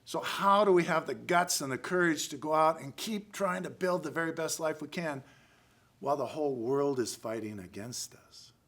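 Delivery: normal talking voice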